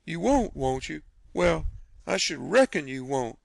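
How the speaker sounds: high, sarcastic retort; a tart aunt sneering at a promise; mocking scorn. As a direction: throw his words back at him. Aunt Sally mocking him.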